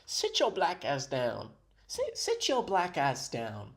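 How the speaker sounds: slow, drawn-out mock command; hammed-up copy of a stern order; mimicking the clip. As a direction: imitate her scolding, stretching each word. Repeats the clip's line just played.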